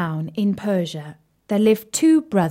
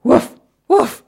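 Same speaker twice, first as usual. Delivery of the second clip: loud barky woof, higher; dog barking; 5 semitones higher, 6 dB louder than usual. Acted. Barker the dog barking at the pond. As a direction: bark it like a big bouncy dog.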